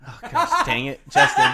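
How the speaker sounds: amused outburst of exasperation; laughing exasperation. Real, not acted. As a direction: groan at a friend's slip through laughter. Exasperated laughter at inattentive co-host.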